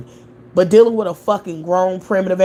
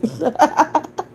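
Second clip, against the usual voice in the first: 1 high cackling laugh; 10 semitones higher than usual. Real, not acted. Laughing at a chat comment.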